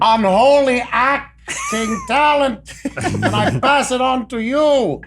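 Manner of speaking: high, slow, bombastic declamation; hammy robot actor. Acted. Robot thespian bestowing his talent on fans, bombastically grand. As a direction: stretch each word out with huge theatrical grandeur.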